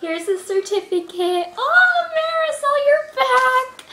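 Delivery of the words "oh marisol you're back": high, joyful cry of welcome; overjoyed reunion, pitched high; happy and excited. Real, not acted. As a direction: greet her in a delighted high voice. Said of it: "I'm so happy and excited".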